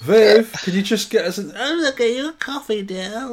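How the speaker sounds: put-on high, loud fussing old auntie; chirpy doting aunt offering coffee; fussy auntie voice. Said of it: "That's Viv. That's Auntie Viv".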